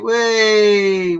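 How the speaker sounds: loud, high, gleeful cry of whee. Real, not acted. Playful call as video starts.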